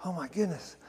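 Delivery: soft, hushed mock of a weeping man; imitated tearful awe. Man weeping in the Lord's presence, soft imitated tearful awe. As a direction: drop softly into imitated overwhelmed awe. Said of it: "He starts to cry and he's like".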